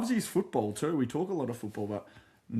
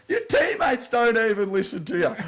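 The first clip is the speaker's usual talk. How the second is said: high, louder laughing jeer; mocking jeer; 9 semitones higher, 5 dB louder than usual. Opponent taunting the kicker, laughing jeer. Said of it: "And then old mate goes".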